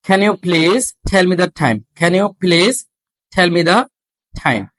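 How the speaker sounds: very slow, deliberate reading with breathy stops; slow drill. Shown as a demonstration. Teacher slowly modelling a question for students. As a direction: read it slowly, puffing each stop. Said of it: "এগুলো আমাদেরকে খেয়াল করতে হবে".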